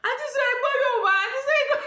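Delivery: very high, loud, quick disbelief; mock a man tickled and astonished, squeaking high; amused conductor. Conductor finding auntie understands Yoruba, mock amused surprise. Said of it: "The conductor just started laughing".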